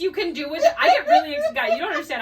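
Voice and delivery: bursting with excitement, high and loud; excited. Real, not acted. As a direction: gush about it, squealing with glee. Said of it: "I get so excited about this water bottle".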